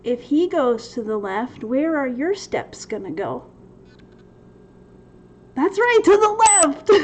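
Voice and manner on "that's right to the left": high, loud, gleeful answer; bright, loud "that's right!"; excited call-out. Acted. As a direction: shout the answer like a game-show host. Quiz answer called out playfully.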